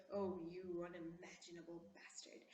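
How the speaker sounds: lightly voiced classy heroine, hushed; quiet, hushed scorn in a refined American voice; Rose's line. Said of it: "She goes".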